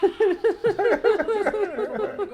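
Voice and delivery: high loud cackle; loud burst of laughter. Real, not acted. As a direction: let the laugh explode. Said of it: "big laugh".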